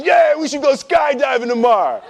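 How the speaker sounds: tipsy friend laughing out the idea; drunken dare. Retold or imitated. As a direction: toss it off like a bar dare. Drunk friend suggests skydiving.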